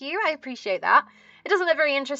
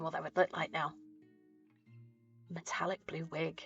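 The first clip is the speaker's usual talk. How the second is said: whisper; 4 semitones lower, 13 dB quieter than usual. Real, not acted. Savouring the idea of the wig.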